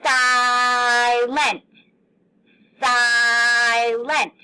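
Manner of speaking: silent with the I stretched long; held-out syllables. Shown as a demonstration. Teacher holding out the I in silent for kids. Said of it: "Listen to what you hold out".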